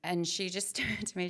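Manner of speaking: usual speaking voice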